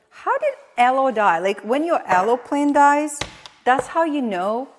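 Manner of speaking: loud, high wail over a dead plant; loud dismay. Real, not acted. Streamer wailing at her dead aloe, loud and high. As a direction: cry out in mock despair.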